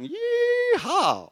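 very high, loud cowboy whoop; yee-haw shout. Pretend. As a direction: whoop like the Hee Haw show. Hee Haw TV show.

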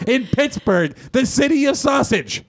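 high, slow, stretched-out incredulity; amazed emphasis. Real, not acted. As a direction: drag out the punchline in a high voice. Astonished climax of the story.

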